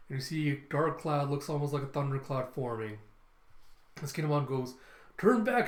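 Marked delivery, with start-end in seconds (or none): 5.2-5.7 high, loud imitated command; urgent warning. Kinemon warning comrade about dark cloud, loud urgent call. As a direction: call out sharply to turn around.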